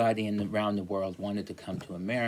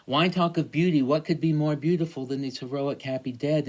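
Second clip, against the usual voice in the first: higher, louder rhetorical declaiming; reading a poem; 6 semitones higher, 5 dB louder than usual. Acted. Teacher reading the speaker's lines, mock-rousing. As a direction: proclaim it like a rousing orator. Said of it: "he's talking about the soldiers".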